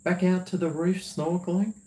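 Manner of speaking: lightly put-on, lifted pleading question; imitating a companion. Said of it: "Melissa said".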